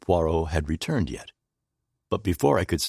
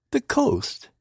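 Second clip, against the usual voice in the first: high puzzled question; puzzled echo of the coast. A narrator's reading. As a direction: echo the word, baffled.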